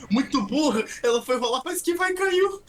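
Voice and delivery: high, loud mocking shout; excited shout. Real, not acted. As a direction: yell it out in delight. Laughing at a rolling player.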